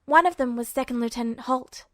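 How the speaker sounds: ordinary delivery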